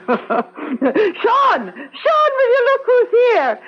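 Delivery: high, laughing call to the next room; calling out excitedly. Acted. Aunt calling her husband to meet the nephew, high laughing call. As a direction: shout for him through a laugh.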